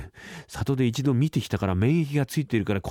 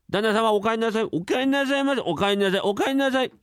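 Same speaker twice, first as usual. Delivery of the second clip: higher, overly polite chorus of greetings; servants' nervous welcome; 9 semitones higher than usual. Acted. Servants greet the returning master, high and nervous. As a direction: servants fake innocence.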